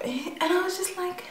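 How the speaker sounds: breathy laugh through the words; laughing whisper. Real, not acted. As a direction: say it while laughing under your breath. Laughing while retelling.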